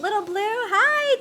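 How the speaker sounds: high, loud cheerful hello; excited greeting. Real, not acted. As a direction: greet them brightly. Greeting viewer on stream.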